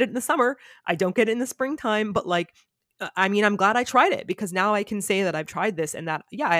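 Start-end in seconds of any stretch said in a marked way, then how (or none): none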